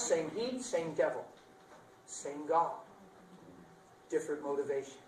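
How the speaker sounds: usual speaking voice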